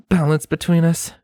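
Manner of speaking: put-on amused pony girl; Scarlet voice. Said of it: "she echoed".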